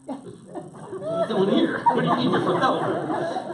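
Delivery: acted-out squawk of incredulous questioning. Passenger to a driver from Delaware, incredulous.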